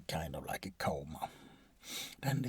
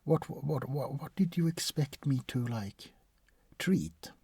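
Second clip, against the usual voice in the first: exasperated disbelief; 9 semitones higher, 5 dB louder than usual. Acted. Exasperated at patient's question.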